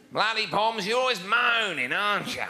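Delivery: drawn-out, high, slow Aussie jeering; mock Australian whinge. Australian mocking English visitors, drawn-out and high. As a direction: stretch the vowels like a scornful Australian. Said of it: "we call you".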